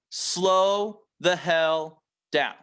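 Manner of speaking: drawn-out, deliberate, every word stretched; slow it right down so each word lands. Shown as a demonstration. Coach to sales reps, modelling a very slow delivery. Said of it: "No matter how slow you think you talk".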